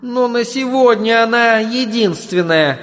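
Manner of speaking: put-on high, indignant demon girl's voice. A narrator's reading. Demon answering master's complaint about the body, indignant. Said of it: "возмутился демон".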